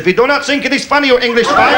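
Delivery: fast, high put-on German; mock German accent. Captain scolding the English pilot, fast mock German accent. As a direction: rattle it off as a German. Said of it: "He said".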